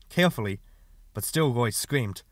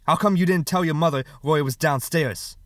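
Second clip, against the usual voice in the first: raised, loud questioning; angry accusation; 5 semitones higher, 6 dB louder than usual. Acted. Father blaming older son for silence, angry accusation. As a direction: demand an explanation from the child. Father interrogates John angrily.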